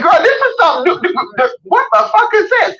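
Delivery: put-on high, incredulous girl-talk, rising to a squeal on the question; mock disbelief. Newcomer to Tennessee hoodoo, in high mock disbelief. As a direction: play up the shock of something strange. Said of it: "and you're like".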